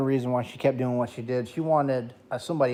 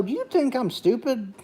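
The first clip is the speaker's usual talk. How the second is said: indignant retort; 10 semitones higher than usual. Retold or imitated. Retorting to the therapist.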